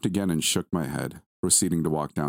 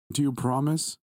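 put-on high, pleading child's voice; sniffling; 9 semitones higher than usual. A narrator's reading. Tearful son seeks dad's promise, mildly sniffly. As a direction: ask as a tearful little boy, understated. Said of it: "he sniffled".

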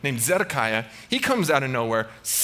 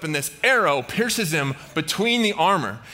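ordinary talk

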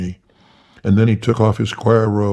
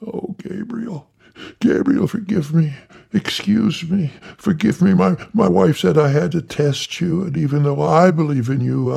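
high, frantic begging for forgiveness; high, fawning little reverend; Tiny Tillman voice; 6 semitones higher than usual. Acted. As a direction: pitch it way up and grovel.

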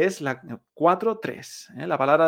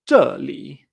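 "zheli" with a punched falling start and dipping end; dry fall, curved tone; 2 semitones lower, 6 dB louder than usual. Shown as a demonstration. Teacher repeating Chinese for here, "zheli" dry then curved. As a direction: drop hard on "zhe", curve through "li". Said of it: "este tercer tono".

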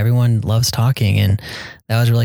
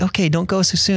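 much higher, sing-song hospitable protest; polite host protest; 9 semitones higher than usual. Retold or imitated. Brazilian host seeing guests out, high sing-song. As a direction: play the gracious host who won't let you go. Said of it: "but really they're like".

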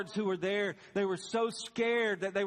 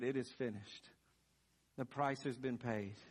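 hushed last words; 7 semitones lower, 7 dB quieter than usual. Pretend. Jesus's last words quoted.

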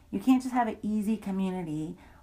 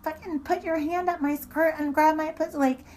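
higher, heated retold accusation; raised, angry retelling of the confrontation; pissed-off accusation; 8 semitones higher, 3 dB louder than usual. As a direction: throw the groping back in her face, heated. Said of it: "And I got really pissed".

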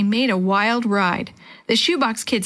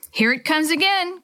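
higher-pitched warning call; 7 semitones higher than usual. A narrator's reading. Boy warning raft mates of a wave, calling out. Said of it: "Chris called".

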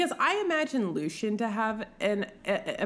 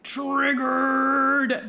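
loud, raised, stretched-out outburst; mock triggered cry; 4 semitones higher, 6 dB louder than usual. Bride sees red rose petals, comically triggered. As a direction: blurt it big and comic. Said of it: "and she's, like".

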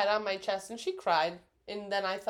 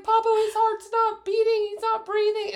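much higher, louder imitation of a frantic cry; retold crying panic; 12 semitones higher, 6 dB louder than usual. Friend quotes grandmother's death call, mock panicked crying. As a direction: play her panic at half strength. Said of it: "she's crying".

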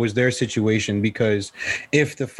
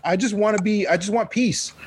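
pleading; 7 semitones higher than usual. Retold or imitated. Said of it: "It's just like, "Oh, listen".

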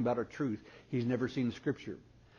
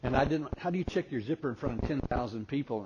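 usual speaking voice

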